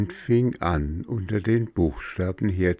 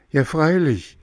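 eager yes; 9 semitones higher, 4 dB louder than usual. A narrator's reading. Pinocchio eagerly answers pigeon.

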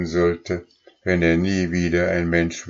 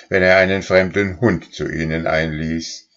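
usual speaking voice

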